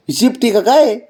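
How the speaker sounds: high laughing question; giggling high coo. Real, not acted. Laughing at pet's reaction.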